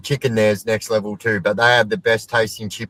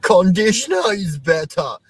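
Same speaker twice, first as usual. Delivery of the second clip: mock-indignant declaration, pitched higher; Billy Madison quote; 7 semitones higher than usual. Quoting a Billy Madison scene to chat, mock indignant. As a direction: ham up a childish argument line. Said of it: "Little bit of a Billy Madison fucking reference".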